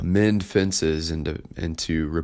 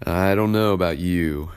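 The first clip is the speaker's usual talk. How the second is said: usual speaking voice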